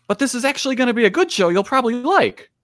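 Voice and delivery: high, fast, disbelieving plea; incredulous plea. Retold or imitated. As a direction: push it up in disbelief, rushing to convince. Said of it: "I'm like".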